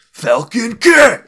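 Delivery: high, slow, drawn-out hype call; mock-epic shout of a hero name; announcer shout. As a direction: bellow it like a game announcer. Hyping a joke superhero name.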